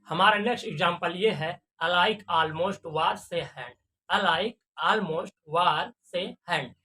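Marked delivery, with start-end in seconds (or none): 1.8-6.8 slow demo reading. Shown as a demonstration. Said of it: "देखो उसका pronunciation कैसे होता है".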